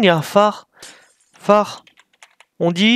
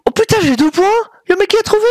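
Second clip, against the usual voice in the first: very high-pitched, incredulous glee; real, giddy surprise; amazed delight; 12 semitones higher, faster than usual. Real, not acted. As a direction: squeal it in disbelief at a lucky win. Surprised at scoring points in game.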